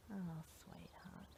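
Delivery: soft, slow, whispered sympathy; tender whisper. Real, not acted. Medium comforting the grieving ghost in a tender whisper.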